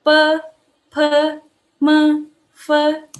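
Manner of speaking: high, level, held syllables; each syllable sustained and separate, for repeating; consonant drill. Shown as a demonstration. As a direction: chant "Pe, pe, me, fe" on one high pitch. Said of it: "kita baca bareng-bareng".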